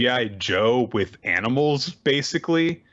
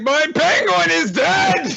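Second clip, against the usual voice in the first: put-on slow, high, loud child wail; hammed-up childish heartbreak; distraught little girl; 6 dB louder, slower than usual. Child finding the toy ripped up, mock wail.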